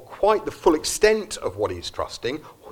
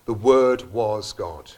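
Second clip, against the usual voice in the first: "The Word was God" said slowly, leaning on "Word"; slow emphasis; 3 semitones lower, 4 dB louder, slower than usual. Shown as a demonstration. Preacher to congregation, stressing "Word" slowly. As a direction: weigh each word, "Word" strongest. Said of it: "That's talking about Jesus".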